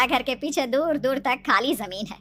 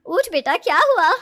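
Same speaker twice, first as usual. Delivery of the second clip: louder, higher, urgent mother's voice; alarmed mother; 6 semitones higher, 6 dB louder than usual. Acted. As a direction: call out anxiously to wake your child. Mother waking frightened daughter. (घबराई माँ की ऊँची आवाज)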